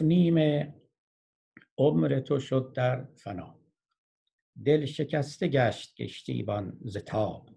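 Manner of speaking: slow, emphatic verse reading with long pauses; poetry recitation. Acted. Teacher reciting the boatman tale to listeners, measured poetry recitation. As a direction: declaim the couplets, stretching the line endings.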